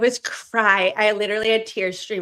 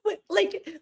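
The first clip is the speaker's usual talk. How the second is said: usual speaking voice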